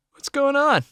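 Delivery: sleepy, high groggy voice; just woken, groggy. A narrator's reading. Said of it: "he asked in a groggy voice".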